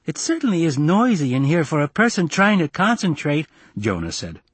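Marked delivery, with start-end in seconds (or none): 0.0-3.5 high, put-on little boy voice; small boy grumbling pointedly; precocious kid's high-pitched complaint; complaining child.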